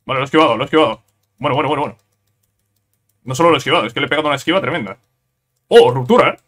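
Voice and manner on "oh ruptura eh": loud, startled exclamation; excited outburst. Real, not acted. Gamer reacting to a rupture in the fight, excited outburst. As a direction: call it out suddenly, louder. Sudden event in the game.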